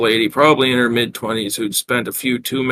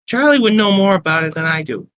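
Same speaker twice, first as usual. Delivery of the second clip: high-pitched Brooklyn barmaid; Brooklyn accent; 8 semitones higher than usual. Acted. Barmaid answering about room thirteen, Brooklyn accent. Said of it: "asked in a heavy Brooklyn accent".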